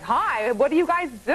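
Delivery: hammed-up Long Island hello; Long Island accent. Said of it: "you talk like that".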